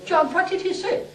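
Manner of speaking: a nosy barber begging for the gossip; eager pleading. Retold or imitated. Barber pressing John to hear the Pope's words, eager and high.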